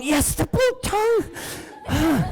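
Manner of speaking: high, slow, drawn-out "blue tongue"; dramatic repeat. Acted. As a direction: stretch "the blue tongue" high, as a mock-grand announcement. Hyped repeat of the reveal.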